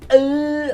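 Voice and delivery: loud, high mock gag; fake retching. Host sickened by a cyberbully, loud fake retching. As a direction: retch theatrically in short, separate bursts. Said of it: "I'm gonna throw up".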